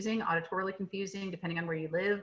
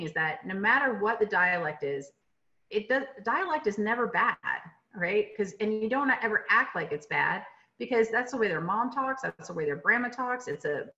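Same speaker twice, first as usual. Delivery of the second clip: usual speaking voice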